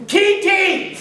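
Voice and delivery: high shout across a ballpark; hollering a name. Retold or imitated. Fan at ball game hollering to player. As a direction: call out to a player on the field. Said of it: "you just holler".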